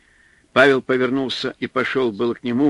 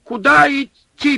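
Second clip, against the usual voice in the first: much higher, loud panicked yell; yelling over wind; 13 semitones higher, 6 dB louder than usual. A narrator's reading. Supply man yelling over the storm, panicked. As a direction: scream it over the howling wind. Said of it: "перекрывая вой ветра, заорал".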